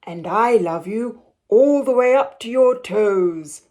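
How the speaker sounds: a hint of a proud father; gently voiced big father hare.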